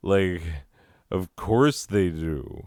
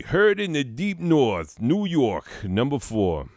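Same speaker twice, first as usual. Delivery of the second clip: high-pitched New York announcer caricature; put-on New York accent; 8 semitones higher than usual. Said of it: "gotta do the accent".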